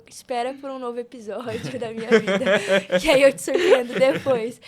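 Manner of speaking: laughing through a playful tease; teasing through real laughter. Real, not acted. Speaker teasing the audience about her next episode, laughing.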